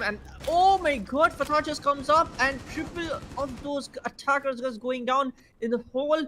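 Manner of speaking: high-pitched, astonished commentary; amazed play-by-play. Real, not acted. Caster stunned by triple of those attackers, amazed.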